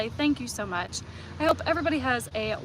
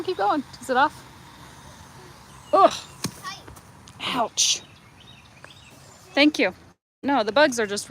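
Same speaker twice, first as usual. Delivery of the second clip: ordinary talk, nothing marked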